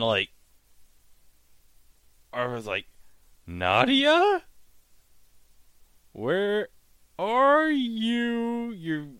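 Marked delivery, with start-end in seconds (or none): 3.5-9.1 high, hammed-up worried call; mock calling out. Imitating Arthur calling for Nadia underwater, high and hammed-up. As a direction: play Arthur calling into the water.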